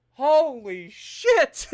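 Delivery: high, loud, slow cry into a laugh; shocked laughter. Real, not acted. Streamer laughing at record battle losses, shocked laughter. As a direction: laugh in disbelief.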